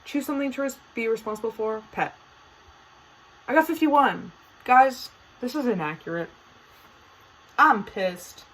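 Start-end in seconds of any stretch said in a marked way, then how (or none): 3.5-8.4 higher and louder, mock outrage; loud playful indignation; indignant outburst. Real, not acted. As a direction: protest the unfair result. Outraged at quiz result.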